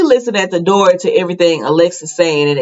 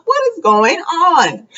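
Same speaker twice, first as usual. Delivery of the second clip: high, drawn-out bewildered question; amused bewilderment, pitched right up; baffled reaction; 10 semitones higher, slower than usual. Retold or imitated. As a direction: stretch it out high in disbelief. Her baffled reaction to the plot.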